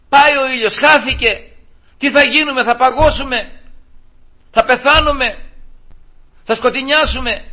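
high, loud panicked questions; mock panic. Preacher voices believers panicking when a cloud hides the sun, mock panic. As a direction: cry out as if all is lost.

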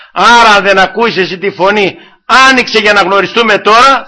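loud, high pulpit appeal; urgent preaching. Acted. Preacher urging the listener to open the door, loud and urgent. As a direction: press them to open up now.

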